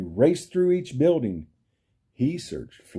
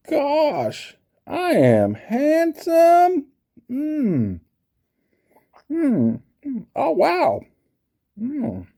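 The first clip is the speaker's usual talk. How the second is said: high, slow preening voice; vain admiration; 13 semitones higher, 4 dB louder, much slower than usual. Pretend. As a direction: admire yourself like a vain beau. Admiring himself in mirror.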